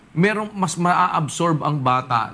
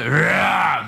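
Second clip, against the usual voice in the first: roar. Shown as a demonstration. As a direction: roar like a lion. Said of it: "That's roar".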